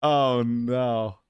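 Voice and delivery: ordinary talk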